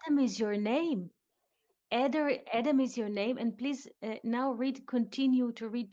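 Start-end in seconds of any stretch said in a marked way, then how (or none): 0.1-3.4 "Adam" louder, said twice, a bit fast; firm statement. Shown as a demonstration. Teacher confirms the reading as a statement. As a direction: insist "Adam is your name". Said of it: "This is a statement".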